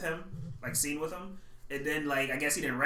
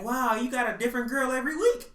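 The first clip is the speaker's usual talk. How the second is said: higher-pitched teasing exclamation with a rising end; teasing a friend with a pretend wow, mock amazed; mock amazement; 9 semitones higher, 4 dB louder than usual. Let the pitch climb in mock wonder.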